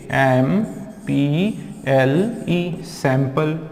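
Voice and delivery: ordinary talk, nothing marked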